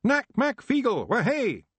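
very high, loud Scots whoop; Feegle cheer. Acted. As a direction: wee men cheering wildly. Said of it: "This was met by a cheer".

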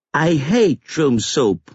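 put-on high, slow little-boy whine; sulky five-year-old voice; petulant child. A narrator's reading. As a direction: drag it out like a defiant small child.

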